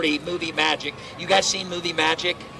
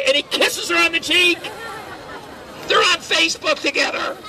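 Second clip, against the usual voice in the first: big punchline shout; 10 semitones higher than usual. Real, not acted. Punchline of James story.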